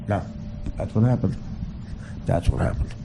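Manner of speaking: usual speaking voice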